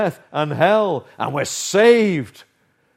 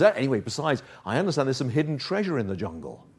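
softer and fast, confiding; sly little voice; 3 semitones lower, 8 dB quieter, much faster than usual. Acted. The little voice of self whispering about hidden treasure, soft and quick. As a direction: slip in the temptation quickly and softly. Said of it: "the little voice says".

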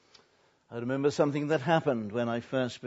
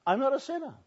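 I'm not a sinner, much higher and insistent; indignant protest; 13 semitones higher, 4 dB louder, faster than usual. Retold or imitated. Woman insisting to the pastor, high mock indignant. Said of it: "she told me".

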